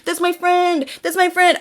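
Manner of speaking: mock pleading defence of a friend; pleading denial. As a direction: beg to believe in the friend. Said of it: "and say".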